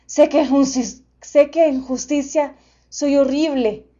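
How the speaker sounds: loud, booming gentle beast; friendly monster voice. A narrator's reading.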